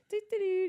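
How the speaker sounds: high, loud mock-innocent doo-doo-doo; nonchalant humming. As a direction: imitate someone acting like nothing happened. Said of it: "just acting like nothing".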